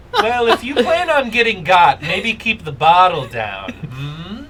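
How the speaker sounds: loud laughing tease; laughing taunt. Acted. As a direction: cackle the warning out loud.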